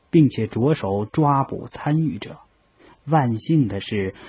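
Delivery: ordinary delivery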